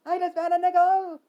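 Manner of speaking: very high, loud shout. Acted. Barry announcing a found nickel, high shout. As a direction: shriek the news out. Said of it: "Barry shouted".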